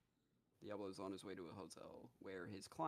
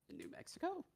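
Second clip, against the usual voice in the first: surprised question; 4 dB louder than usual. Retold or imitated. Caller surprised by the news.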